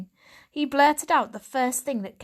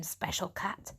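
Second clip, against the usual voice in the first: disgusted; 9 dB quieter than usual. A narrator's reading. Stray cat mocking the pampered newcomer, mild disgust. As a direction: sneer the words. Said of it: "She sounded disgusted".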